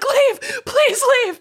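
high, slow, half-laughing plea; mock pleading. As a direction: beg them to go away. Mean because embarrassed.

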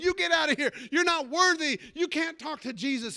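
mock crowd scolding a beggar; crowd jeering him off. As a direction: shoo him away like an angry crowd. Crowd driving blind man away.

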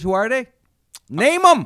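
high, loud outburst; indignant demand. Real, not acted. Comic challenging co-host over jealous critics, indignant and loud. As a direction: demand the names, raising your voice.

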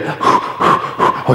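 breathy puffed whews; mock relieved exhale. Pastor imitates believer calming, breathy mock exhales. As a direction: puff out air to calm down. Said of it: "Or like".

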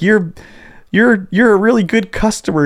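a hint of a meek, polite lady; mock her weak, too-nice tone; high, gentle mock dominatrix; timid dominatrix. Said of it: "she's like".

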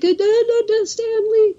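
high starstruck stammer, acted out; freaking out. Kid telling mom he can't approach Stan Lee, freaking out. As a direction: babble it high like an overwhelmed little fan. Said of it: "freaking out".

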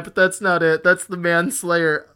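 ordinary delivery

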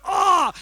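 high, loud winded cry; winded grunt. Retold or imitated. Punched in the gut, high loud winded cry. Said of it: "I'm like".

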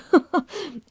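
short amused laugh from above; indulgent laugh. A narrator's reading. Inspector amused by his sergeant's boast, indulgent laugh. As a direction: chuckle like you're humouring a child. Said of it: "Stilton laughed with an air of indulgent amusement".

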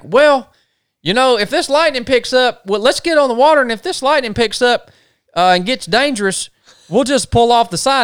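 high-pitched mock voice of a trip mate; Brett. Camper mimicking his buddy's plan before the storm, high mock voice. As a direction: imitate a buddy hedging, pitched well up.

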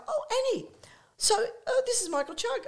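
high, breezy acted chatter; high-pitched social gush; excited introduction. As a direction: introduce someone to a friend brightly.

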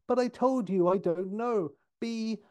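high, pleading protest; almost crying. Retold or imitated. Redhead being shouted at by Six, nearly tearful. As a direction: act on the edge of tears. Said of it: "and she almost cries".

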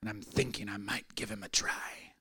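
a dying atheist tells his caretaker he'll try Jesus, whispering; frail whisper. Retold or imitated. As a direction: breathe it out like a dying man's secret. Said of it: "He says".

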